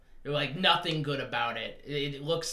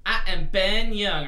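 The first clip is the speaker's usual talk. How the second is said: dramatic self-introduction; 3 semitones higher, 9 dB louder than usual. Pretend. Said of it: "can you say that again".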